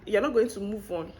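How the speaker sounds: usual speaking voice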